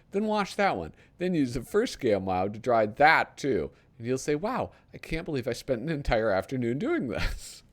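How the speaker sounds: lightly hammed-up TV pitchman read; infomercial read. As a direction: sell it like a late-night ad. Reads a parody TV ad.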